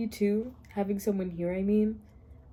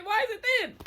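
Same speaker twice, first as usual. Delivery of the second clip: high whine; 11 semitones higher than usual. Real, not acted. Repeated outraged question.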